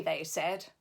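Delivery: ordinary delivery, nothing marked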